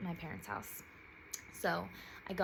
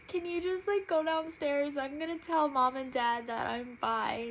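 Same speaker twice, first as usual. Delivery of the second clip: higher, louder tearful request; urgent, welling-up voice; emotional plea; 8 semitones higher, 5 dB louder than usual. Retold or imitated. Asking her sister to come downstairs, tearful and urgent.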